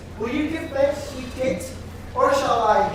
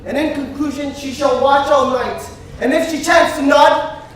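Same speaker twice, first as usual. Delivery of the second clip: ordinary talk, nothing marked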